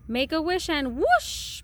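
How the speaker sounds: plain reading voice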